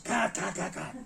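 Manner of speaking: high-pitched mimicked director's call; retold shout. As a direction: call a halt like an impatient director. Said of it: "My master shouts".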